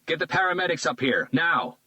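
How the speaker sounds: high, loud urgent command; barked order. A narrator's reading. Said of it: "he ordered".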